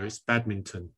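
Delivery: badminton with a distinct T in it; corrective pronunciation. Shown as a demonstration. Co-host gives friends a pronunciation lesson, badminton with its T. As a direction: say badminton, sounding out the T. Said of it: "we need a pronunciation lesson".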